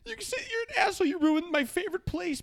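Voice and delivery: very high, shrieky imitation of an offended woman; high-pitched mocking impression; mock outraged heckler. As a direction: squeal it like a scandalized heckler. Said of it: "and she said".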